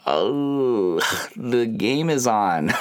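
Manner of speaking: slow, laughing excuse; sheepish laughing dodge. Retold or imitated. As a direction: chuckle through a lame excuse. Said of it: "And I'm like".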